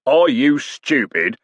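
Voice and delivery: slow, drawn-out scornful question. Acted. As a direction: pour contempt on the idiot. Coachman scoffs at hostler.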